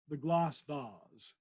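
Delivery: very slow "glass vase", "vase" as "vahz"; posh glass vase. Shown as a demonstration. Reader showing how the vicar would say "glass vase". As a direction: draw out "the glass vase", "vase" rhyming with "cars". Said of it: "I think you would say".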